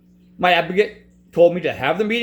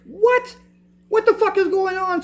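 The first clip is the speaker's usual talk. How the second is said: much higher, hammed-up shocked outburst; exaggerated outraged advocate; 12 semitones higher than usual. Advocate reacting to the denied application, hammed-up high outrage. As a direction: overplay her outrage as a squeal. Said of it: "She didn't say it like that".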